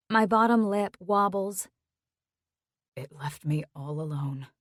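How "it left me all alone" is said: a soft rasp, quieter. Acted. Boyfriend admitting loneliness, quiet rasp. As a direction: rasp it out, hurt and hushed. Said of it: "He rasps out".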